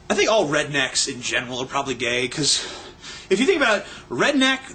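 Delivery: normal talking voice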